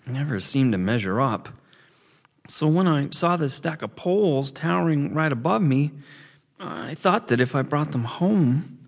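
put-on high, hurt young beaver; holding back tears; tearful kid voice. A narrator's reading. Tearful boy confesses.

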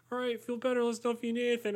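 high, sweet imitation of friends; mock the friends' chirpy kindness up high. Imitating sweet friends saying feel better, high and chirpy. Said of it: "they're always like".

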